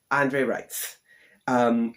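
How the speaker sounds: ordinary talk, nothing marked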